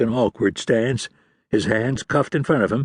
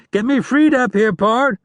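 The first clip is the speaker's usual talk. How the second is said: high frantic shout, much higher and louder; 14 semitones higher, 5 dB louder than usual. A narrator's reading. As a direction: scream it to your partner. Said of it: "Lang shouted".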